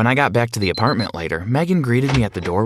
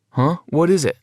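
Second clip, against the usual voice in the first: mouth full; 7 semitones higher than usual. A narrator's reading. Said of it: "through a mouthful of food".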